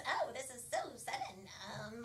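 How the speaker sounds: Pelican Barbie. Pretend. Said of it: "Pelican Barbie says".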